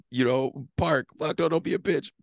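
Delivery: high, rapid back-and-forth with himself; heated inner argument. Retold or imitated. Two sides of him fighting in the car, high and heated. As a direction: bicker with yourself, pitch way up. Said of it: "literally a mental battle".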